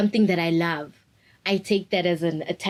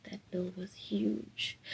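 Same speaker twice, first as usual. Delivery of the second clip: slow, breathy whisper, much softer; whispered shock; 12 dB quieter, slower than usual. Real, not acted. Whispering about the huge glasses bill. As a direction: let the shock sink in quietly.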